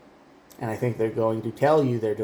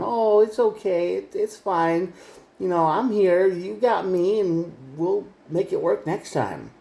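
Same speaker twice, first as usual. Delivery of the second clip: higher, syrupy mock-comforting voice; fake sympathy; 6 semitones higher, slower than usual. The manipulator pretending to console you, in mock sweet sympathy. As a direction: imitate a false friend soothing you after the fall. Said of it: "They do that encouraging, uh, sympathetic nonsense".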